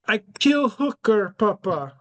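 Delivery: put-on high, slow European accent, dropping the article; Barron with a European accent. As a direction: son confessing to dad in a high European accent. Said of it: "he speaks with a European accent".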